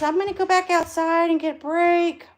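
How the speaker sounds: high, loud sing-song dog voice; voicing the dog. Breeder speaking for the mother dog to viewers, high playful dog voice. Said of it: "She says".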